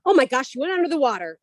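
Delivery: quick, high excited exclamation; amazed kids. Retold or imitated. Mother imitating her kids at the pool, excited. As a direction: blurt it like an astonished kid. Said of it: "my kids were like".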